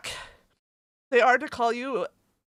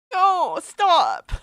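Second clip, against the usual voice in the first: tearful protest; 6 semitones higher, 8 dB louder than usual. Real, not acted. Overwhelmed by fan letter.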